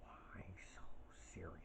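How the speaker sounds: soft, dragged-out whisper with an edge; Heath Ledger's Joker. Shown as a demonstration. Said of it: "you have to whisper a little and then drag it out".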